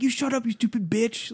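high-pitched mock rage, held back in volume; imitated furious husband. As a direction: mimic a husband losing it at his wife. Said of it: "they were just losing their minds".

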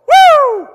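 a high, ecstatic preacher's woo; shouted woo of pure praise; joyful whoop. Real, not acted. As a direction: throw your head back and whoop for joy. Joyful outburst mid-sermon.

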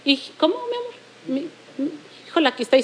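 a hint of a sweet, hushed nurse. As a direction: imitate a nurse cooing softly to a patient. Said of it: "las enfermeras ... suelen mucho decir". (imitación ligera de enfermera, suave y cariñosa)

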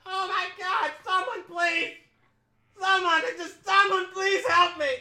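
high-pitched frantic plea for help; acted terrified teller, high voice; panicked bank teller. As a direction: beg for rescue in a squealing panic. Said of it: "the teller's like".

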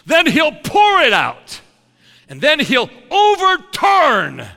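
thundering healing sermon shouts; full-force preaching. Acted. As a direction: drive every line out like a preacher on fire.

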